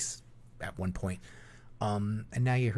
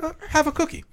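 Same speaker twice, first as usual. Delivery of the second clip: much higher sweet offering; pitched-up playful cookie offer; mock girlish decoy; 4 dB louder than usual. Decoy offering a cookie, high and sweet. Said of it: "I'd be like".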